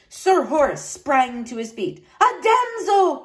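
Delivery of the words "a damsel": high, drawn-out knightly exclamation; excited cry. A narrator's reading. Knight hearing a damsel, excited high cry. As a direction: cry it out with sudden zeal. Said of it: "he cried".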